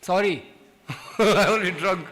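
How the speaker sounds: loud, sheepish apology with a laugh. Retold or imitated. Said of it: "I said".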